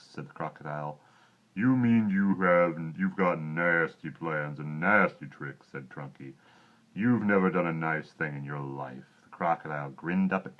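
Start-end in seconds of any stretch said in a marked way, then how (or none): 1.5-9.0 big deep elephant voice. Acted. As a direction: scold the croc slowly and grandly.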